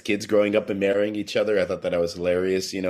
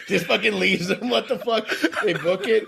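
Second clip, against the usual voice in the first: giggling through the words, voice pitched up; laughing; 10 semitones higher than usual. Real, not acted. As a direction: laugh it out, half amused and half disbelieving. Laughing while retelling scene.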